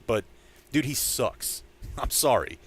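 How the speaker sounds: ordinary talk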